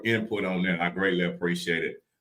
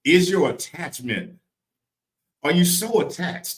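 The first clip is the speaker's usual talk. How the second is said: raised, emphatic preacher delivery; preaching; 10 semitones higher, 5 dB louder than usual. Real, not acted. Preacher challenging listeners on attachment, preaching. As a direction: press the question on the listeners.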